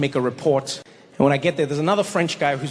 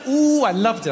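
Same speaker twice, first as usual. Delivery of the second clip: usual speaking voice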